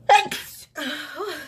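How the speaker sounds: loud, high sneeze-like exhale; sneezy sigh. Real, not acted. Allergies kicking in on camera, loud sneezy exhale. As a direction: let out a loud sneezy breath. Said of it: "This is my allergies kicking in".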